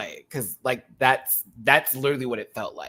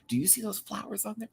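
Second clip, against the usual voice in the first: softer, quicker, higher repeat; softer quick take; 9 semitones higher, 7 dB quieter, faster than usual. Shown as a demonstration. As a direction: rattle it off softer. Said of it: "This is me".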